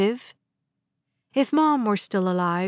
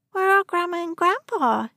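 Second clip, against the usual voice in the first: put-on high child's voice, confused; little daughter asking for her grandparents; little girl; 9 semitones higher than usual. A narrator's reading. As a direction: ask like a puzzled small child.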